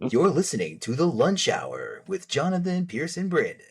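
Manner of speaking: radio show announcer intro. Acted. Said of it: "we got our announcer here".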